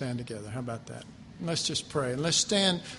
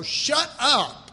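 higher, louder blunt "Shut up."; crude Texan shut up; 7 semitones higher, 7 dB louder than usual. Retold or imitated. Preacher telling his own soul to shut up, loud and blunt. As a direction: snap it at your own soul. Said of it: "I'm crude, I'm Texan".

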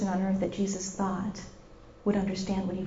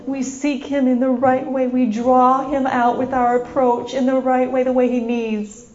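loud, raised, pleading prayer; fervent prayer; 4 semitones higher, 7 dB louder than usual. Real, not acted. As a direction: pray it out with rising force. Leads congregation in fervent prayer.

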